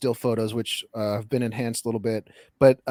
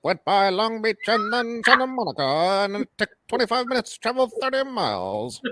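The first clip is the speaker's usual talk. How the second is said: high, bright 1940s newsreel announcer; old-timey radio voice; 9 semitones higher than usual. Retold or imitated. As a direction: announce it like a wartime radio newsman. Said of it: "the old-timey radio voice".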